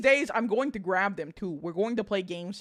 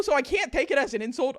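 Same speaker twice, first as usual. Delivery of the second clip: ordinary talk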